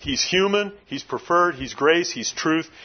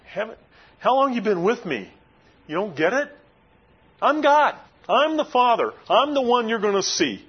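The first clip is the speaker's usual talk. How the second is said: higher-pitched, exasperated retort, building on I'M GOD; mild rebuke; 8 semitones higher than usual. Retold or imitated. Jesus, as the preacher imagines him, rebuking Philip, exasperated. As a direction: voice an incredulous teacher setting a pupil straight. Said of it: "he kinda rebuked Philip a little bit".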